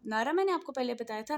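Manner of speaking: ordinary delivery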